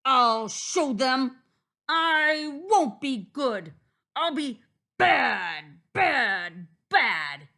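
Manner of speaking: loud, slow, emphatic vow; slow, booming wolf tantrum; angry wolf shouting. A narrator's reading. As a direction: shout each word with defiant weight. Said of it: "shouted Big Bad Wolf".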